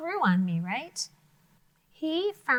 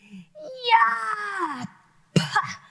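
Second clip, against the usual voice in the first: higher, loud disgusted monster yell; monster spitting; 10 semitones higher, 7 dB louder than usual. Acted. Teacher voicing the monster spitting out the bitter toad. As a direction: spit the toad out with a roar. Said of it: "and he is like".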